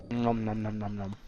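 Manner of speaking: chewing while talking; talking through a mouthful of pizza; mouth full. Real, not acted. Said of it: "Sorry for the chewing sounds".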